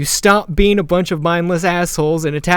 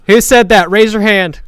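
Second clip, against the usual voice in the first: louder, higher demand; commanding call; 5 semitones higher, 6 dB louder than usual. Acted. Rider demands the heckler show himself, loud commanding call. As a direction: order a crowd about.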